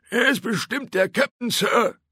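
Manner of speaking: high, eager crewman's voice; sailor reporting. A narrator's reading. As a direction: call out a report to the captain. Sailor reporting loudly to Drake.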